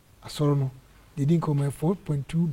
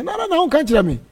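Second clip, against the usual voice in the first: high, loud, rapid-repeated "na, na, na"; excited protest; 12 semitones higher, 6 dB louder than usual. Retold or imitated. Pastor answering Prince about the Australia trip, excited protest. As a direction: cut in with an animated objection. Acted reply to caller.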